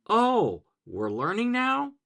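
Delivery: high surprised realization; sudden startled discovery. Retold or imitated. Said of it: "their brains go".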